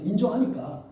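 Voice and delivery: ordinary talk, nothing marked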